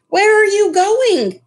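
high, loud startled cry; cried out. Acted. Mama Zhang calling after the wok as it rolls away, crying out. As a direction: shout after the runaway wok. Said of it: "cried Mama Zhang".

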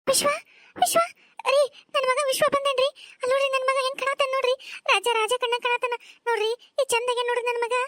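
thrilled motherly exclaiming; overjoyed mother. Acted. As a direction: marvel aloud at your son's arrival. Mother sees her long-absent son arrive.

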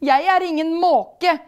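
loud, high winded protest; panting. A narrator's reading. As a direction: protest while out of breath. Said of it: "peser Pokus".

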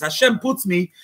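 ordinary delivery

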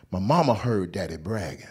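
ordinary talk

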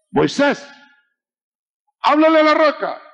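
high, loud divine command; commanding shout. Acted. Preacher voicing God, shouting his command to Moses. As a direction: call out to Moses like God from above.

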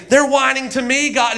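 high mock whine; hammed-up tattling complaint; Moses. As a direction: whine it up to God. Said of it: "now he's whining to God".

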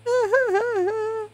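high crying villain laugh; hammed-up sinister cackle; wicked wailing laugh; evil cackle.

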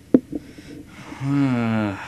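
a long loud sigh, falling away; heavy sigh. Real, not acted. Gamer sighing at his long conquest plan, a loud tired sigh. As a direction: let the breath out hard and sag.

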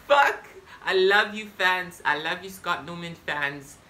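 high, loud squeal of joy with a laugh; thrilled outburst. Acted. Announcing the Met Gala invite to fans, laughing squeal of delight.

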